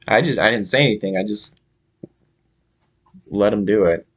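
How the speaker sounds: ordinary talk, nothing marked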